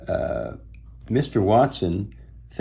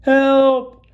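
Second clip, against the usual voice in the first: shout for help; 7 dB louder than usual. A narrator's reading.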